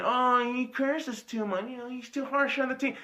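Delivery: a hint of whiny critic, quietly mimicked; mocking the critics. Ranting fan mocks critics of his cursing in a lightly whiny voice. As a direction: lightly sneer out their complaint. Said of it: "Talking about my fucking".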